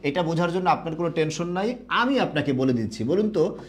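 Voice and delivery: ordinary talk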